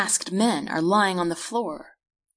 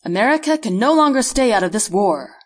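raised, emphatic presidential address; charismatic president; 4 semitones higher, 6 dB louder than usual. A narrator's reading. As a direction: declare it like a charismatic leader. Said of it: "in his charismatic voice".

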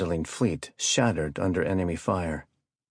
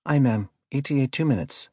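crisp acknowledgment; 6 semitones higher than usual. A narrator's reading. Helmsman acknowledges admiral's order.

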